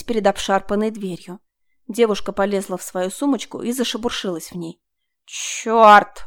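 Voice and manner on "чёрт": blurted out, loud; sudden curse. A narrator's reading. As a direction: let the curse burst out. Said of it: "вырвалось у неё".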